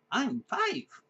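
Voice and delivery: put-on high proud little kid voice; childlike proud announcement; proud child. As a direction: announce it like a beaming five-year-old. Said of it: "proudly announced".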